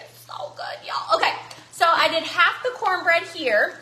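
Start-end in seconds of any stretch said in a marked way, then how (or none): 0.0-0.9 hushed whisper of delight. Real, not acted. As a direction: breathe it out unvoiced. Whispered praise of dish.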